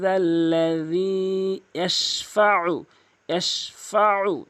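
ইয়াশফাউ with a pressed throat ain; strong ain. Shown as a demonstration. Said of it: "আইনটি চাপ দিয়ে বলবেন".

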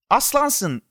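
high-pitched happy exclamation; delighted cry. A narrator's reading. Boy cheering his friend who brings juice, exclaiming. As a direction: cry out with glee. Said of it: "diye haykırdı".

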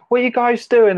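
loud, high call down the stairs; shouting downstairs. Retold or imitated. To drunk parents boiling a kettle downstairs, loud high alarmed shout. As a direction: yell it from upstairs, alarmed. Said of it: "I shout down".

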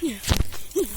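ordinary delivery

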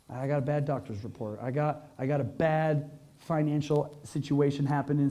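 low, soft, heavy-hearted admission; downcast confiding. Pretend. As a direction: own up quietly to bad news. Voices a person in hard times.

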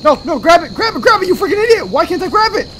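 high, loud panicked scolding; frantic yelling. Real, not acted. Player failing to grab the item, frantic.

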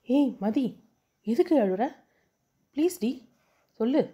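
anxious pleading. A narrator's reading. Charu begs her crying friend Mathi, anxious and pleading. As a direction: plead with worry as tears start. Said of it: "பதட்டத்துடன்".

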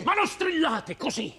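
high, sharp protest; exasperated shout. Acted. Guest tells landlady to stop shrieking, exasperated shout. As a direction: shout over the other person's scolding.

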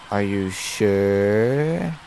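slow, long-drawn SURE; ghost's drawn-out question. Acted. Ghost asks the player if they are sure, drawn-out. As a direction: stretch the last word out slowly.

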